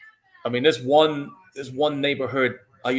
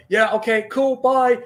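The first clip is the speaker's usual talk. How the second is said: high, bright, overly breezy brush-off, louder; forced casual; 10 semitones higher, 6 dB louder, slower than usual. Retold or imitated. Stoned driver hiding it from a cop, mock casual and higher. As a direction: rush a fake-casual goodbye to sound sober. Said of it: "You're like".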